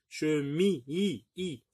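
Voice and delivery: "mi" echoed by two high, separate "i" sounds; isolated i sound. Shown as a demonstration. Teacher making pupils hear i, "mi" then isolated "i, i". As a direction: break up "Che, mi", then repeat "i" on its own. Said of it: "vous entendez i".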